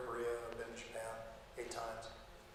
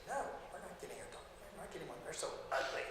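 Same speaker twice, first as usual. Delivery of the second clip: wife's refusal; 4 semitones higher, 6 dB quieter, faster than usual. Retold or imitated. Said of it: "just like that".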